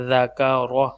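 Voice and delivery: slow, drawn-out letter-by-letter drill; measured Qaida teacher's recitation; spelling drill recitation. Shown as a demonstration. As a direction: intone each letter, then its syllable. Drills spelling out Quranic syllables.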